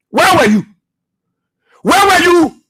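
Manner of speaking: angry shout. Real, not acted. Angry harangue at opponents.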